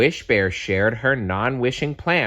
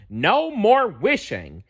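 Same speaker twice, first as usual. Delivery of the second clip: high, slow, firm cry; 12 semitones higher than usual. A narrator's reading. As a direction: lay down the rule slowly. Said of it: "Wish Bear cried".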